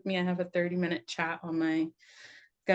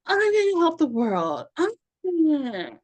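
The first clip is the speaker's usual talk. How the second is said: grandiose self; 10 semitones higher, 9 dB louder than usual. Retold or imitated. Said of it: "the audacity to be like".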